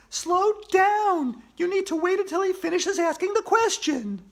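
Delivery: put-on high, loud girl's voice, not whispered; Andrea whispering. A narrator's reading. Andrea scolding her teammate, high and loud. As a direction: scold him as the upset girl, pitched high.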